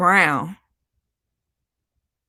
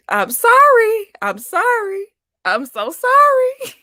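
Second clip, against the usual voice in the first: very high, helpless squeal; squealed apology; 14 semitones higher, 4 dB louder than usual. Real, not acted. Apologising for losing it over the baby, squealed apology. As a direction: shoot up high on each sorry.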